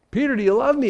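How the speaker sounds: insistent, urgent third asking; pressing question. Retold or imitated. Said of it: "It grieved him that he would ask".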